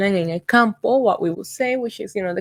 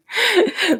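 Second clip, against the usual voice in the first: short high burst of laughter; a quick high giggle; 10 semitones higher than usual. Real, not acted. Laughs about spooky tours.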